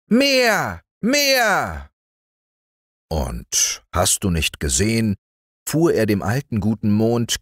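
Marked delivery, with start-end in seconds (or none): 0.1-1.9 put-on high, bossy child yelling; demanding little boy. Häwelmann ordering the moon to shine, high bossy yell. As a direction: order the moon about like a spoiled small boy. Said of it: "schrie der kleine Häwelmann".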